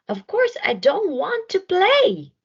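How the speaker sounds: grumpy snort. Acted. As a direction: snap the refusal like a sulky child. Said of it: "snorted Hudson".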